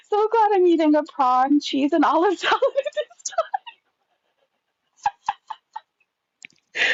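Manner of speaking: high voice breaking into laughter; real giggling through the words; laughing. Real, not acted. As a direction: talk until laughter takes over. Laughing about eating while reading.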